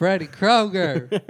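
very loud, high, drawn-out Southern accent; mock Southern drawl. Shown as a demonstration. Friend echoes Freddy Kroger in a hammed-up Southern drawl. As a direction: belt out the name in exaggerated Southern. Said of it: "like that Southern drawl".

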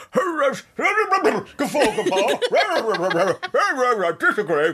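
high, hooting guffaws in Parliament; mock posh MP heckling; braying MPs. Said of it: "all you hear is".

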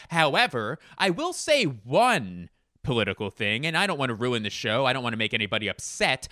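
lightly put-on stand-up comic, swooping upward on key words; impression of a comedian building to a punchline; John Mulaney. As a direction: play an earnest disclaimer with theatrical emphasis. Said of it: "I'll have him do one of my famous political riffs".

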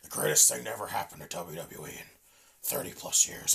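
a hint of a low, lifeless old boss; lightly mocking Vince's croaking speech; Vince's dead voice. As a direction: mumble it, nearly breathless. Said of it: "his fucking dead voice".

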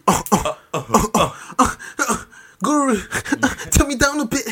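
high-pitched mock-crying rapper ad-libs. Host doing a rapper's mic check, high tearful impression. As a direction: impersonate a rapper who sounds close to tears. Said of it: "Why does he always sound like he's crying".

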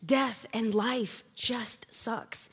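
slow whispered aside. Real, not acted. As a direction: breathe it out like a confession. Aside on grief.

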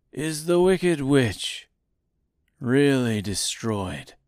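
lightly voiced slow wizard; wizard's voice. A narrator's reading. Hidden wizard questioning Dorothy, lightly voiced slow wizard. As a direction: ask it slowly, a hint of a mysterious wizard. Narrator voices Oz's mysterious voice.

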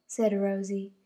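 ordinary delivery

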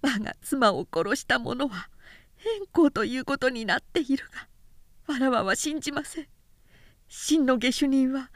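grieving widow confiding her plan for revenge; tearful noblewoman. Acted. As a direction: beg the two warriors for help through tears. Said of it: "そして泣いて言うには".

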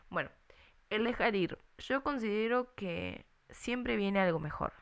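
ordinary talk, nothing marked

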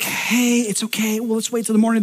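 soft, calming parent voice; soothing hush. Retold or imitated. Parent calming kids at night, soft soothing hush. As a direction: settle the kids down gently.

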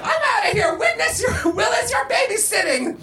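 high, hammed-up shouted parting order; frantic exit. A narrator's reading. Edna leaves Willis babysitting, frantic exit.

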